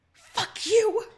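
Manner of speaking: screamed, high and loud; furious snarl. Acted. The dying Empress snarls at you, screaming. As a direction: hurl it at her killer. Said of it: "she snarls".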